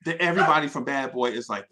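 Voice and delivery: ordinary talk, nothing marked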